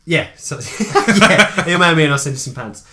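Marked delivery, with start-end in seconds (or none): 0.6-1.1 laugh. Real, not acted. Laughing at pants joke.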